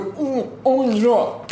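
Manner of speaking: hammy eating moans. Retold or imitated. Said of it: "He's like".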